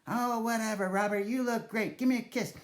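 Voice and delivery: put-on high, dismissive old lady; a hint of a pushy granny; lightly voiced granny. Nana brushing him off to demand a kiss, lightly voiced granny.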